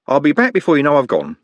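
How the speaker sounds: bright. A narrator's reading. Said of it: "said brightly".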